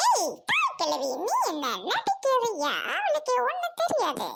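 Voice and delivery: high-pitched, put-on scolding voice; squabbling character. Acted quarrel with old woman. (உச்சக் குரலில் சண்டை)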